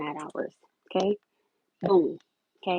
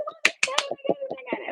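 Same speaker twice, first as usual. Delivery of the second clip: overjoyed; faster than usual. Real, not acted. Telling mom she got the place.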